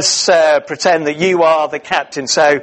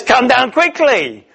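high, slow, arrogant royal command; lightly voiced arrogant king commanding; demanding king; 8 semitones higher than usual. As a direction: order him down like a haughty king. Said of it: "demanding, arrogant".

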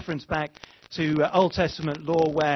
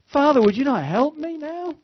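much higher, louder plea for help; desperate cry; 12 semitones higher, 7 dB louder than usual. Pretend. Believer in crisis crying to the Father, loud and desperate. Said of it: "That's the word to cry".

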